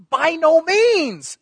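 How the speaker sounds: high, loud and slow proclamation; exclamation shout. Acted. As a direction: thunder it out slowly, with the exclamation point. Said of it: "Exclamation point is his".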